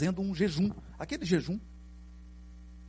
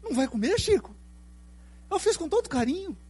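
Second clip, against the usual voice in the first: sweet hostess voice; 11 semitones higher, 4 dB quieter than usual. Retold or imitated. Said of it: "E a dona olhando".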